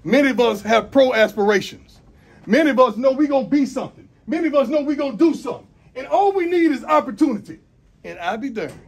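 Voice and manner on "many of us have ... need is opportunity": higher, louder, building up; pep speech. Real, not acted. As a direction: preach it to the team. Coach builds intensity.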